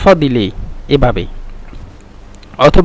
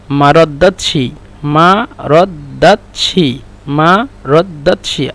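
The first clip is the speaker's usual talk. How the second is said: slow drill; much slower than usual. Shown as a demonstration. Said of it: "repeating word slowly for students".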